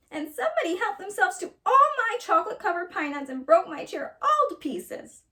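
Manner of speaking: high, loud tearful cub squeal; squealing little bear. A narrator's reading. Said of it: "squealed Little Honey in his little voice".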